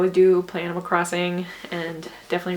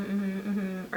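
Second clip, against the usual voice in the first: ordinary talk, nothing marked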